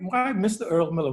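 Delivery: usual speaking voice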